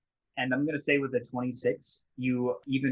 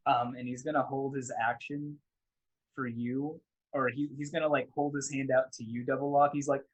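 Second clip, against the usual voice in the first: ordinary talk, nothing marked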